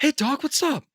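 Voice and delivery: high, friendly movie-star impression; Mark Wahlberg. Showing off the impression after praise, high put-on voice. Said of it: "you do a good Mark Wahlberg".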